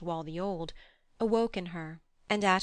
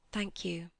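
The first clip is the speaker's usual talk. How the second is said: whispered thanks; 3 dB quieter than usual. Acted. Lucy, cornered, answers faintly.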